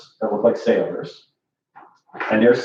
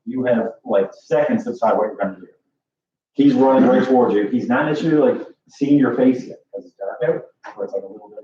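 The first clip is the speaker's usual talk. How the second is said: ordinary talk, nothing marked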